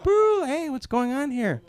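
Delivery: high honk, then indignant protest; playing the victim. Grandmother honking after hitting a lady, high mock indignation. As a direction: honk and complain high, as if wronged. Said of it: "she honks like she's the victim".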